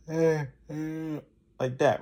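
open throat. Shown as a demonstration. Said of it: "speak through your throat, While your throat is open".

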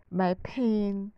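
ordinary talk, nothing marked

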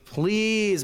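usual speaking voice

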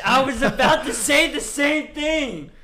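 loud, slow outraged yell; excited shout. Real, not acted. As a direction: shout it in disbelief. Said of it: "That was a lot of frequency".

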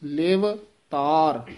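ਲਿਵ and ਤਾਰ said apart, slowly; separate words. Shown as a demonstration. Teacher separates ਲਿਵ ਤਾਰ for students, slow. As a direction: say ਲਿਵ, a small break, then ਤਾਰ. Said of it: "ਵੱਖਰਾ ਵੱਖਰਾ ਬੋਲਣਾ ਹੈ".

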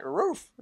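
high mock bark; British dog bark. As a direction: yip it like a posh dog. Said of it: "how would they bark in a British accent".

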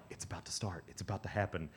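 hushed, secretive passing of word; whispering. Retold or imitated. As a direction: pass it along very softly, like a secret. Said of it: "like, whispering".

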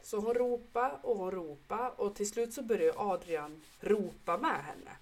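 ordinary talk

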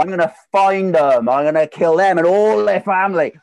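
loud, high, hammed-up fury; playful over-the-top threat; mock rage. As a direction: rant like a bidder gone mad over being outbid. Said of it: "this huge rage and frustration".

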